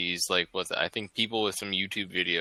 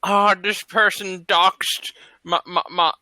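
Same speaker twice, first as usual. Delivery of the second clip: put-on slow, higher voice of an old YouTuber in a care home; old person voice; 7 semitones higher, slower than usual. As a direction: play a doddering old resident, slowly. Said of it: "it's gonna be like".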